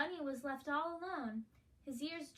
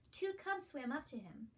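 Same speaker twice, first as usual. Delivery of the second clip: ordinary delivery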